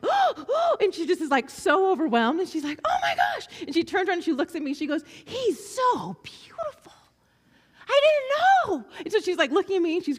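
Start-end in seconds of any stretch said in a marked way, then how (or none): 0.0-0.8 high, louder breathless cry of wonder; little girl overwhelmed in worship, high awed cry; overwhelmed gasp. Retold or imitated. 2.8-3.5 high, louder cry of amazement; awed little girl. Retold or imitated. Girl overwhelmed. 5.2-8.9 high, slow breathy wonder rising louder; hushed then bursting out as a child in tears; girl turning to her counselor, high slow awe; awed tearful girl. Retold or imitated.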